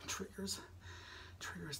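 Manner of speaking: hushed and airy; soft breathy whisper. Acted. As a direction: breathe the word softly. ASMR trigger word whispered.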